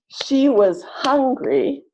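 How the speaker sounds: HUN of hungry long and strong, was reduced; slow demo, She was HUNgry; stressed syllables longer. Shown as a demonstration. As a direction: stretch hungry's first syllable, high and slow. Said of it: "notice that the stressed".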